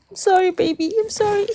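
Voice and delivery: put-on high, cooing apology to a child; high, sugary mommy voice saying sorry; sweet apology. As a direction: coo it up high like soothing a baby. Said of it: "you go like".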